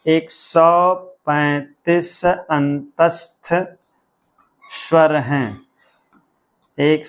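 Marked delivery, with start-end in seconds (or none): 0.0-5.6 very slow, drawn-out chant of एक सौ पैंतीस अंतस्थ स्वर हैं; slow mnemonic recital. Shown as a demonstration. Teacher recites the counting trick slowly so students memorise it. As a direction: spell out the memory trick word by word. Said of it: "सीधा सा एक trick बताया गया था".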